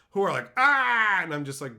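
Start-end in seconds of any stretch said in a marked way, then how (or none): 0.6-1.2 loud, high mocking scream; high yelp imitating an attacker; mock outraged scream. Online weirdos coming at him, mock screaming. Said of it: "these fucking weirdos who come at me".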